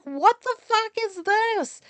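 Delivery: high, loud, drawn-out disbelief; appalled outburst. Retold or imitated. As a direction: recoil in horror, pitch shooting up. Retold outrage at gruesome photo.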